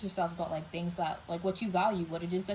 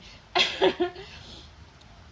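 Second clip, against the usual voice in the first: embarrassed laugh; 12 semitones higher, 5 dB louder than usual. Real, not acted. Apologetic laugh after ranting.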